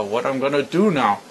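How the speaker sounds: ordinary delivery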